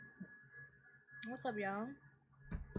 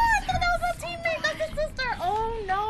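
frantic rush; 14 semitones higher, 7 dB louder, much faster than usual. Real, not acted. Still panicking over the mistake.

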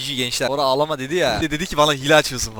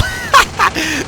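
laughing; 5 dB louder than usual. Real, not acted. Laughs at sudden game twist.

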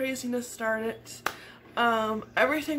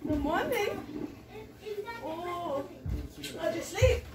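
soft sing-song greeting; 6 semitones higher, 6 dB quieter, slower than usual. Real, not acted. Mother greeting child softly.

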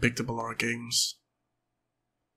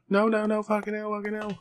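high put-on panic, fading off; imagined game character's voice; 9 semitones higher than usual. Player imagining how the character speaks, high panicky voice. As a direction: squeal it, then let it tail away. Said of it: "so imagine him speak".

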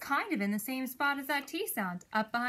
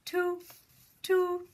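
high, short horn toots; crisp imitation of a horn; 7 semitones higher, 3 dB louder than usual. Shown as a demonstration. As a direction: toot on one held high note like a little horn. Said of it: "What does a horn say?".

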